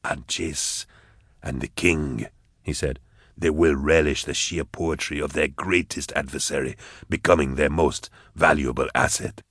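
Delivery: low, soft calculating schemer; quietly scheming, voice dropped low; scheming villain. Acted. Goblika relishing victory.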